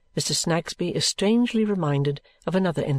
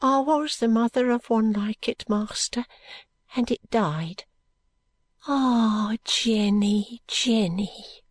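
grieving poor mother; 8 semitones higher, much slower than usual. Acted. Grieving mother recalls her dead child.

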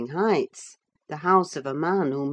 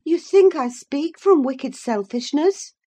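high, raised-voice question; indignant challenge; 10 semitones higher, 7 dB louder than usual. Acted. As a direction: demand it with affronted emphasis. Catherine challenges Isabella in quarrel.

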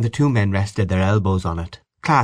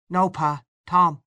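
put-on high-pitched child voice; little boy; 9 semitones higher, slower than usual. A narrator's reading. Little boy tells Pa who he is, high child voice. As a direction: pitch it up like a small son.

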